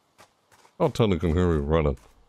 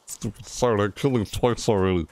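normal talking voice

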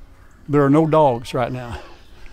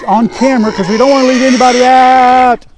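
louder and higher to talk over the noisy pigs; raised voice; 9 semitones higher, 7 dB louder than usual. Real, not acted. Farmer raising his voice over loud pigs, loud and high. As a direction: push the voice up over the squealing pigs. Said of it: "I start raising my voice 'cause I gotta keep up with the pigs".

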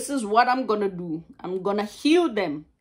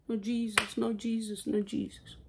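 hushed repetition; 9 dB quieter than usual. Real, not acted. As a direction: drop right down and repeat it quietly. Preacher drops to repeated aside.

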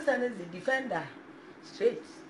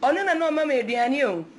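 mock wail; 5 semitones higher, 5 dB louder, faster than usual. Mock wailing to mama.

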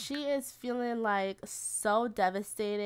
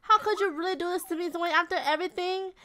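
higher-pitched, hurt acted protest; betrayed girlfriend; 6 semitones higher than usual. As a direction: play her confronting a cheating boyfriend. Acting betrayed girlfriend confronting Dwayne.

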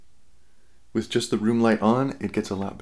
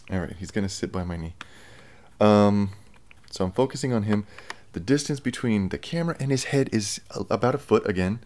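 normal talking voice

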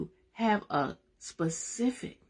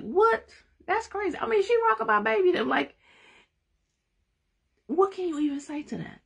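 much higher, incredulous exclamations; amazed disbelief. Real, not acted. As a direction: marvel at the story. Marveling at gangster Bible story.

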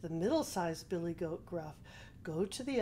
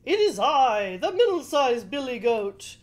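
loud, raised goat voice; middle-sized billy goat; 4 semitones higher, 9 dB louder than usual. A narrator's reading. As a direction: answer the troll loudly as a goat. Middle goat answers the troll.

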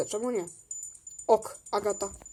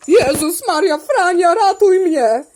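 loud, raised cry for help; play-acted panic; 4 semitones higher, 6 dB louder, slower than usual. Begging Frania to save her from the lion, mock-panicked. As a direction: call out in mock alarm.

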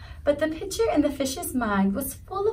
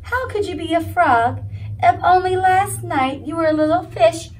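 higher, louder, disbelieving minnow; arguing minnow; 7 semitones higher, 7 dB louder than usual. A narrator's reading. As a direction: protest like a stubborn little fish.

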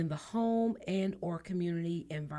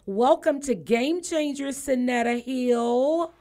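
big, loud, raised welcome, drawing out the name; announcer welcome; 5 semitones higher, 7 dB louder, slower than usual. Real, not acted. Radio host bringing on first guest, big announcer welcome. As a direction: announce the guest with a high, stretched finish.

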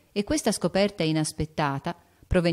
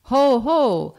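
put-on a loud, high "ho ho" from a boy; playful boyish chuckle; hearty mock laugh; 7 semitones higher, 8 dB louder than usual. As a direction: laugh it out big and jolly. Lori's letter laughs.

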